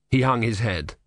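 usual speaking voice

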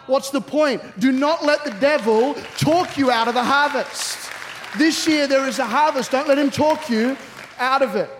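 high, forceful preacher's charge; preaching at full force. Real, not acted. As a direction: drive the point home to the whole congregation. Preacher drives home the main point.

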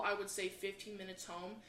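ordinary delivery

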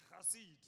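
"chesed" with a rasped KH opening; Hebrew pronunciation. Shown as a demonstration. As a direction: model "chesed" as khah-SEED for the congregation. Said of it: "Pronounce it".

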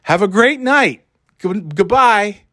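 high, chirpy send-off, goodbye drawn out slowly; sing-song it like an eager clerk; mock cheerful clerk.

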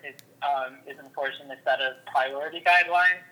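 ordinary talk

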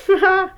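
wild cackle over a dead man's things; shrill cackle. Acted. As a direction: cackle with greedy glee. Old woman gloating over loot.

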